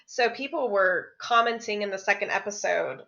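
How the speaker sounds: normal talking voice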